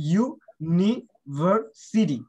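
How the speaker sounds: University very slowly, in four separate parts; syllable by syllable. Shown as a demonstration. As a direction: sound out ইউ, নি, ভার, city with gaps. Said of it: "চারটা syllable আছে".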